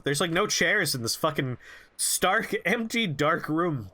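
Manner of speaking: ordinary talk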